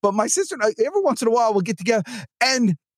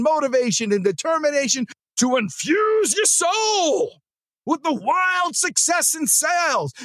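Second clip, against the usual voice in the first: higher, hyped show-open announcer read; podcast intro; 5 semitones higher than usual. Acted. Host opening the daily sales podcast for listeners, upbeat announcer read. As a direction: sell the show intro like a radio promo.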